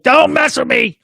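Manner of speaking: high-pitched mock threat; screaming woman. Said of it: "she's screaming at me".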